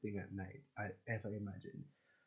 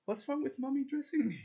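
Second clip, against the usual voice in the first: put-on high, wounded little-kid voice; innocent child voice; 6 dB louder than usual. Acting hurt when teased about mom, high childish whimper. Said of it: "I literally start to cry".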